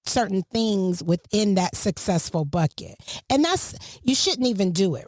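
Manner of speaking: ordinary talk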